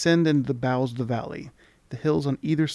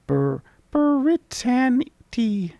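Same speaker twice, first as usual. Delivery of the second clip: much higher, very slow, struggling name; stumbling sprite voice; 11 semitones higher, 6 dB louder, much slower than usual. Acted. Mini mite stumbling over the village name. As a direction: sound it out slowly in the tiny voice. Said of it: "I can never say this".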